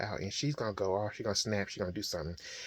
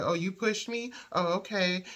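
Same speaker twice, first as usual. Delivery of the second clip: high-pitched affronted comeback; acted indignation; 7 semitones higher than usual. Wife reacting to being pushed, indignant and high. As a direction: imitate someone offended, pitch jumping up.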